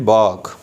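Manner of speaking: British bark, drawn out as "baak"; British pronunciation. Shown as a demonstration. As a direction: drop the R in bark. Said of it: "In British we will say".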